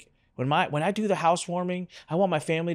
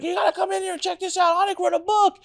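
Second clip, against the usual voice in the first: much higher, excited call to the others. Retold or imitated. Cousin calling her kids and husband, high excited shouting. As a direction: summon the whole family, thrilled. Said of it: "She starts screaming".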